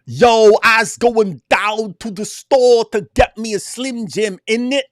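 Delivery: high, loud street British caricature; slow, hammed-up dim London accent; idiotic British accent. Host mocking stupid accents, high idiotic British voice. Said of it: "you sound like an idiot".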